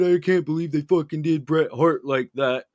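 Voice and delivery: usual speaking voice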